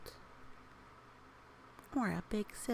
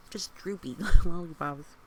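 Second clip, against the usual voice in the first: laughing mid-word, much louder; 13 dB louder than usual. Real, not acted. Reader laughing at the picture's droopy lollipops.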